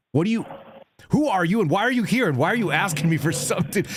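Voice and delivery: put-on grilling the pool kid, building irritation; heated interrogation. Retold angry grilling. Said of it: "I was really pissed".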